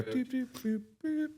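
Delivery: light sung doop noises, quiet and high; easy effort sounds. Retold or imitated. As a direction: toss off each bag with a breezy doop. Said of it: "I just pick 'em up and I go".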